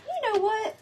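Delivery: high, bright sudden idea; mock epiphany. Designer retells her sudden idea to viewers, high-pitched. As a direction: pop it up like a lightbulb moment. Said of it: "I thought to myself".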